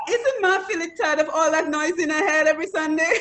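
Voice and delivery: Dominican Creole English lilt; Dominican accent. Retold or imitated. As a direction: say it like a Dominican would. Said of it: "I'm gonna say it like a Dominican would say it".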